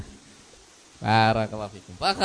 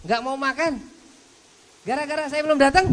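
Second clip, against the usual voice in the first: higher, quicker, a touch exasperated; lightly voiced Abu Bakar; 13 semitones higher than usual. Abu Bakar complaining to his guests, a little higher and fast. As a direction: play the host, mildly fed up. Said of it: "Abu Bakar mengatakan".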